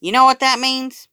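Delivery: high, loud teasing call; rally the whole room; shouting kid. A narrator's reading. A kid shouts to the cafeteria before the food fight, high and loud. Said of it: "someone else shouted".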